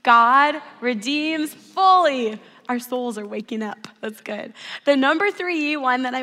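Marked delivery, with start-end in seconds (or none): 0.0-2.4 loud, slow, drawn-out proclamation; leading group recitation. Real, not acted. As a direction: lead everyone together, stretching each word loudly. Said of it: "Let's say it together. Ready?".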